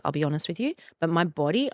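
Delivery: normal talking voice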